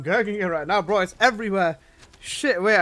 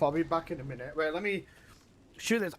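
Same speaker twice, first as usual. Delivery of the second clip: ordinary talk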